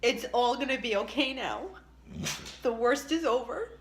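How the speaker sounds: tearful, high and breaking voice; crying. Real, not acted. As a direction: choke up through the words. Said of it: "this could make me cry".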